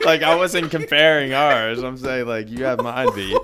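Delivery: high, loud laughing words. Real, not acted. Joking with a friend about family size, laughing.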